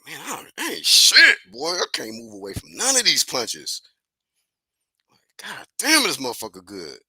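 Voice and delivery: mock a beaten boxer groaning at himself; imagined Canelo. Mock Canelo's inner voice, frustrated at his beating. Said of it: "he was thinking that".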